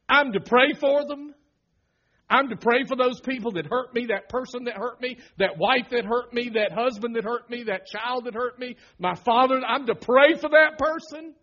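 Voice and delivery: loud, high, incredulous outcry; indignant protest. Pretend. Pastor pretending outrage at being told to pray for enemies. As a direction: protest the command in disbelief.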